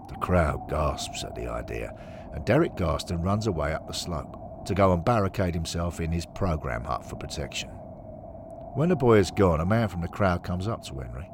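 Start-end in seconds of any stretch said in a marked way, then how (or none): none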